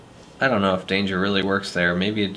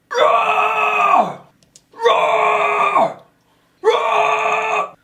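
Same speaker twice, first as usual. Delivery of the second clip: screamed roar, much higher and louder; monster roar; 6 dB louder, much slower than usual. Acted. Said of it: "It still says rar".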